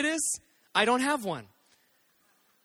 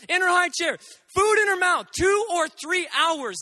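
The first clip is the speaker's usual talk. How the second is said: louder and higher, building disbelief; exasperated; 5 semitones higher, 4 dB louder than usual. Real, not acted. Parent telling the crowd, exasperated and loud. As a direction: shout the absurd details.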